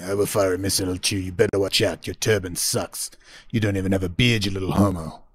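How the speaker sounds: low-pitched hammed-up comeback; mock retorting brother. Second brother answers in mock fight.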